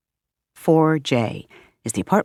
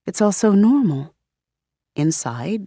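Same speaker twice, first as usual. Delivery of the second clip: usual speaking voice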